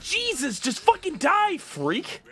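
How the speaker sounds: very high, loud furious yelling; real gamer rage; enraged shout. Real, not acted. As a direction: scream at the enemy to die. Enraged during a fight.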